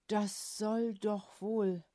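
slow, halting, shaken by a dreadful suspicion; unsure voice. A narrator's reading. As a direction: stretch out soll slowly with a falling tune. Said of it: "fragte er mit unsicherer Stimme". („soll“ langsam gedehnt, fallend, verunsichert)

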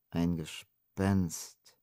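very slow, soft and stretched; drawn out. A narrator's reading. Adams repeats the girl's words, slow and drawn out. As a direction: let each word drag in doubt. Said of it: "wiederholte Adams gedehnt".

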